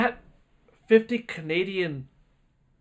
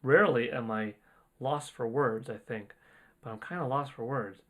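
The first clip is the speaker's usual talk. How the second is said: awed and quiet; 5 semitones lower, 8 dB quieter than usual. Real, not acted. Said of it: "out of body experience here".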